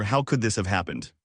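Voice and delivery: ordinary delivery, nothing marked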